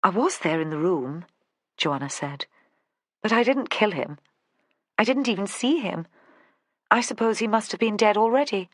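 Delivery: lightly voiced posh southern English lady, cool; a hint of a calm posh southern English denial. Joanna insists to Vera in a posh southern English accent. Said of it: "in the posh southern accent".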